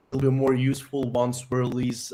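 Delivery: normal talking voice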